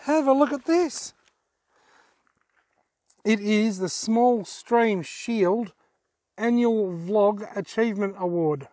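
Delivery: ordinary talk, nothing marked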